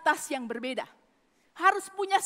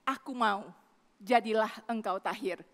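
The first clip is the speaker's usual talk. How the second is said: gentle command; 7 semitones lower, 4 dB quieter than usual. Retold or imitated. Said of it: "Tuhan Yesus berkata".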